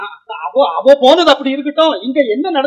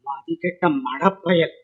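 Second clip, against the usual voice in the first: low, slow, soft cursing; disgusted aside, dropped low and quiet; grumbled insult; 9 semitones lower, 11 dB quieter, slower than usual. Acted. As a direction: grumble it slowly under your breath. Elder grumbles an insult at nephew.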